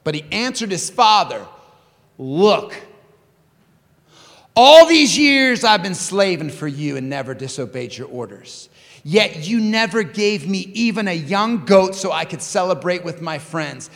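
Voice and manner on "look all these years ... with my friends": resentful complaint, loud on the accusations; angry older brother. Acted. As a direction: protest to your father, building in indignation. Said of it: "the older brother was angry".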